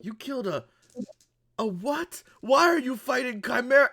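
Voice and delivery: high, halting, incredulous questions; high-pitched disbelief. Acted. Grant to a small fighter claiming a kill, incredulous.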